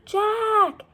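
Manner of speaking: high, loud shout of a name; calling out. A narrator's reading. Annie calling down to Jack in the moat. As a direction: yell down from above. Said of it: "Annie was calling from above".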